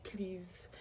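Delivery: softly retold plea; begging. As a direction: plead under your breath. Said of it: "I was already begging".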